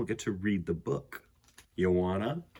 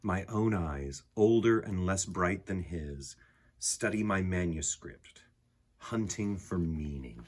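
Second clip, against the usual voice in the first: calm, softer read-aloud voice with unhurried pauses; gentle poem reading; 4 semitones lower, 4 dB quieter than usual. A narrator's reading. Reading the picture book poem to listening children, calm and soft. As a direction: read it like a quiet bedtime poem.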